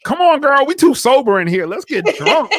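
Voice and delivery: high, loud, laughing mock of a party girl; mock party friend. Friend egging the bride on to drink, high and laughing. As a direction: whoop it up like a tipsy bridesmaid. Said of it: "she's like".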